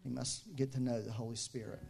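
ordinary delivery

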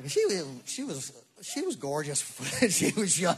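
talking through real laughter; laughing. Real, not acted. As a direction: break up laughing mid-sentence. Laughing about old celebrity crush.

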